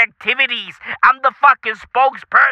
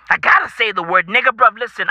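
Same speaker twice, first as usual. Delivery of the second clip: ordinary talk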